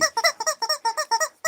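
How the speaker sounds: squealing high laugh; high-pitched giggle. Real, not acted. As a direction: burst into a shrieking laugh. Laughing at Skip.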